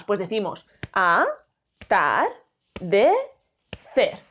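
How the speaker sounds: spaced classroom syllables. Shown as a demonstration. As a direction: drill "atardecer" beat by beat. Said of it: "¿Cómo la dividimos en sílabas?".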